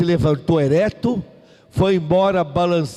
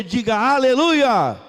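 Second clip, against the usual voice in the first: fervent praise; 7 semitones higher than usual. Real, not acted. Preacher rallies the congregation. (louvor fervoroso, mais alto e agudo)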